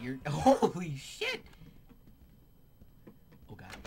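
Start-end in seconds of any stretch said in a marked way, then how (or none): 0.0-1.4 startled laughing shout. Real, not acted. Shocked during fight.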